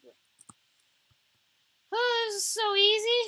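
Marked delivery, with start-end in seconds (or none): 1.9-3.2 loud, high gloating cry; delighted cackling brag; gleeful boast. Real, not acted. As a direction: crow it out like it's too easy. Gleeful while killing zombies.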